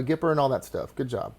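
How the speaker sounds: ordinary talk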